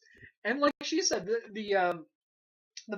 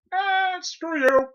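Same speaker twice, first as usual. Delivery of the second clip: dismissive jock; 11 semitones higher, 11 dB louder, much slower than usual. Retold or imitated. Mock quote of jock character.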